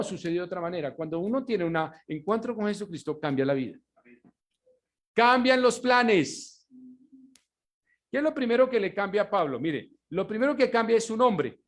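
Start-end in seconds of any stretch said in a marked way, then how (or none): 5.1-6.7 each word drawn out and pressed; slow, loud, weighty declaration; slow emphatic preaching point; slow emphatic climax. Real, not acted. Sermon climax line.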